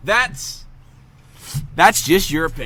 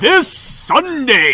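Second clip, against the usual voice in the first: high, slow monster truck promo voice; 7 semitones higher, much slower than usual. Pretend. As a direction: announce it like a truck rally ad. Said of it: "be like the monster truck guy".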